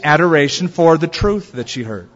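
ordinary delivery

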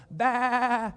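high, drawn-out sheep's baa; high-pitched imitated bleating. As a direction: bleat like an innocent lamb.

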